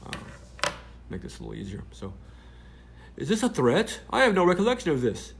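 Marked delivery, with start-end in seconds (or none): 3.2-4.0 high, loud mock British reporter; a hammed-up British accent, pitched high. Reporter's DM read in a mock British accent. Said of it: "I'm doing her British accent". 4.1-5.3 high, loud mock British reporter; a hammed-up British accent, pitched high. Reporter's DM read in a mock British accent. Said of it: "I'm doing her British accent".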